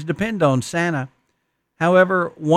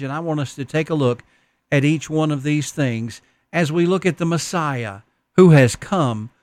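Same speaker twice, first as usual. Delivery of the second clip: ordinary delivery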